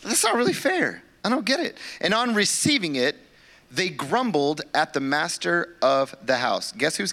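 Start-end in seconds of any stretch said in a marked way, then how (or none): 0.0-1.8 much higher, mock indignant hold up; imitating a protest. Congregation imagined objecting to the wages, mock indignant. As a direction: play the listener who objects to the pay. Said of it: "Aren't we sitting here going".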